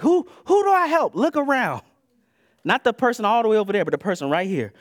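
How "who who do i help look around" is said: high, loud imitation of a baffled listener; hammed-up clueless questioner; mock bewilderment. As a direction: exaggerate the question, pitch shooting way up.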